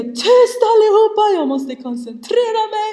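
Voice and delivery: high, loud reenacted scolding shout; yelling. Stressed mamma to children while driving, re-enacted yell. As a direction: shout to silence the whole car, hammed-up. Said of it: "jag skriker lite".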